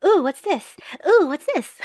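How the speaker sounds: high, loud wide-eyed wonder; like a kid in a candy store; excited kid. Pretend. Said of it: "excitedly, like a kid in a candy store".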